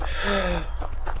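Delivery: soft, drawn-in gasp; shocked gasp. Real, not acted. Player seeing the massacre, shocked gasp. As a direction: suck in a breath in shock. Shock at finding everybody dead.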